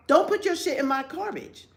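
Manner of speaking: high, scolding warning to neighbors. Retold or imitated. Wife warning dog walkers off her garbage, scolding and high. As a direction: warn them off your trash can.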